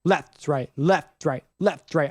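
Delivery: left leaned on, right dropped; marching count. Shown as a demonstration. Marcher calling left foot first as the drill leads. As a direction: call it like a march with left stressed. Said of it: "my left foot led".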